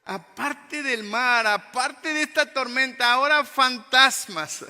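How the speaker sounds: loud, high cry of dismay; alarmed disciples. Acted. Disciples seeing a ghost in the storm, loudly alarmed. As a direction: exclaim it slowly in exasperated alarm. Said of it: "Y empezaron a decir".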